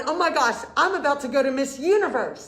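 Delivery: excited realisation. Acted. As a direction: gush it as the stunned new winner. Voicing the new winner's realisation.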